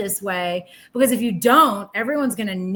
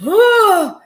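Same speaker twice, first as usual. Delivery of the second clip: disgusted ugh; 9 semitones higher, 10 dB louder than usual. Retold or imitated. Reacting to fake positivity.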